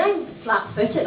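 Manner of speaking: ordinary talk, nothing marked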